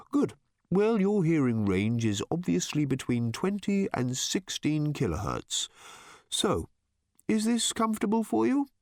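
light, friendly tenor bird voice; pleasant light tenor. A narrator's reading. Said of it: "it said in a pleasant light tenor".

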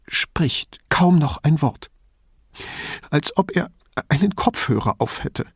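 worried mother. A narrator's reading. Troubled mother describing son.

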